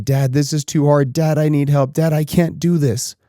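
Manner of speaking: lightly voiced crying toddler begging dad for help; imitating sobbing. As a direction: echo the child's pleading without real tears. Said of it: "Sobbing, crying".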